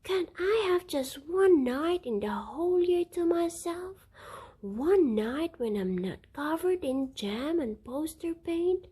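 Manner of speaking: high, loud, fed-up mother; exasperated rant. A narrator's reading.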